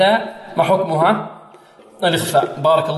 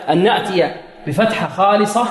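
ordinary talk

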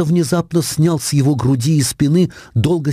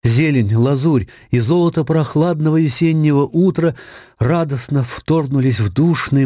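plain reading voice

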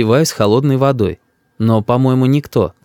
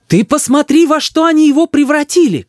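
high-pitched indignant cry; exasperated, high mother's shout; 4 dB louder than usual. A narrator's reading. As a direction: cry out in outrage at the child's behaviour. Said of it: "кричит мама".

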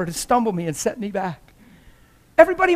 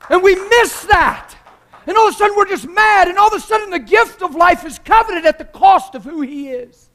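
high, loud, driving sermon voice; preaching at full force; 8 semitones higher, 7 dB louder than usual. Acted. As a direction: hammer the truth home to the congregation.

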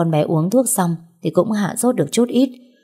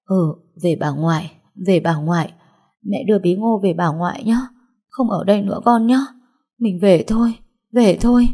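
tearful murmur; 2 semitones higher than usual. A narrator's reading. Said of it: "Tôi vừa khóc vừa lẩm bẩm".

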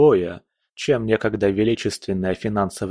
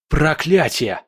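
higher-pitched, forceful swearing; angry curse; 5 semitones higher, slower than usual. A narrator's reading. Hero swears as shadows devour everyone, angry curse. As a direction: spit out a frustrated curse. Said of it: "выругался я".

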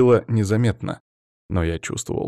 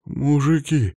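very slow, higher-pitched casualty's voice; mumbling injured man; 4 semitones higher, much slower than usual. A narrator's reading. Injured man thanking his rescuers, mumbling. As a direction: drag out the thanks, dazed. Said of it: "забормотал он".

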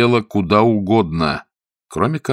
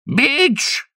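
high-pitched call of a name; crying out. A narrator's reading. Lord summoning his butler, high cry. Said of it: "вскричал лорд Эмсворт".

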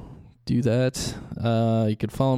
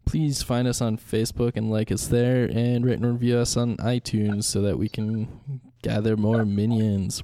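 ordinary delivery

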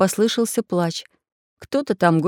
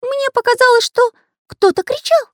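very high squeaky voice, loud and agitated; worried Карандаш; 6 dB louder than usual. A narrator's reading. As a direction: squeak it out anxiously. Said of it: "волновался художник".

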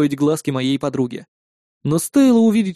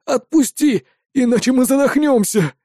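high-pitched plea for air; suffering, raised-pitch protest; strained groan; 8 semitones higher than usual. A narrator's reading. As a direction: plead as if being squeezed breathless. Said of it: "простонал я".

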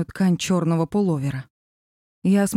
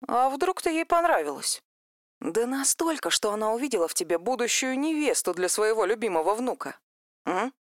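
higher, softer sly teasing; 4 semitones higher, 6 dB quieter than usual. Acted. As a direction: needle her playfully, softly. Vika teasing about bride.

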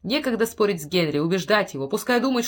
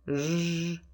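buzzing hum; 6 dB quieter than usual. A narrator's reading. Imitating buzzing sound.

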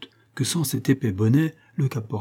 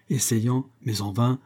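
ordinary delivery

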